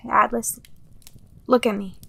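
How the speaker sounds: ordinary talk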